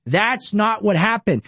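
ordinary talk, nothing marked

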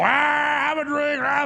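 put-on high, slow, shouted drunken holler; drunk agent. Secret Service agent in a bar urging another round, high drunken shout. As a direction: whoop it up like a tipsy partygoer at 1 AM. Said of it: "they're all drunk and everything".

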